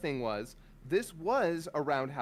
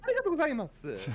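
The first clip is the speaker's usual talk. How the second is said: high cartoonish Mario-style Japanese thanks; Mario Japanese accent; 11 semitones higher, 4 dB louder, faster than usual. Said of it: "doing the Mario Japanese accent".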